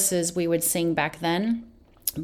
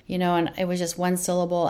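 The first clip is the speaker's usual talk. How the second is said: ordinary talk, nothing marked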